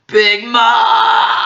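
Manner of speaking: high, loud shout; alarmed shout. Acted. Crew member shouting for Big Mom, alarmed shout. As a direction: yell the name in alarm.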